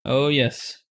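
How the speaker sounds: normal talking voice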